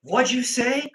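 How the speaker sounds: high, loud imitation of an angry challenge; mock confrontational challenge. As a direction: act out someone demanding a repeat. Retold confrontation, angry men answer back.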